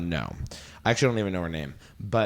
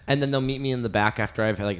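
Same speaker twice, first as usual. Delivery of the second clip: ordinary delivery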